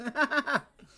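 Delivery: high-pitched laughing burst; high burst of laughter. Real, not acted. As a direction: let out a squeaky laugh.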